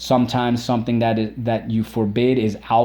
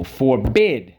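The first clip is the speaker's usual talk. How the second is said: "Forbid" with the weight on BID; stress on second syllable. Shown as a demonstration. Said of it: "the accent is on the second syllable".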